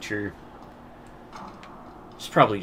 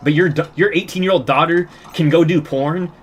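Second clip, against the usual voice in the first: retold comeback; 8 semitones higher, 9 dB louder than usual. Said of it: "And I was like".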